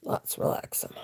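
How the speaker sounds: a quick hushed whisper; whispered aside. Real, not acted. Joking to her viewers about relaxing, whispered. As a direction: let it slip out as a whisper.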